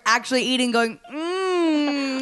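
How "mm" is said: high happy mm; pleasured food moan. Retold or imitated. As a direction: moan like the food's amazing. Moaning over food.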